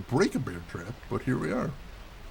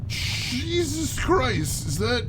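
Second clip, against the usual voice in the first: alarmed surprise; 11 semitones higher, slower than usual. Real, not acted. Spots a giant spider.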